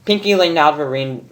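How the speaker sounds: plain reading voice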